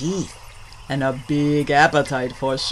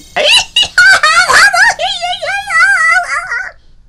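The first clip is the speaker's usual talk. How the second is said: high, loud shrieking cackles; hammed-up hysterical squealing laughter; laughing till crying; 14 dB louder than usual. All three critters fall about mocking gator, hysterical laughter. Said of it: "and laugh till they cry".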